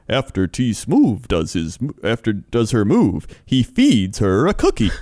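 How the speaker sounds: slow, weird narrator with swooping emphasis; creepy narrator impression. Imitating the Cheaters narrator, weird and creepy. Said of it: "one of the weirdest, creepiest narrators".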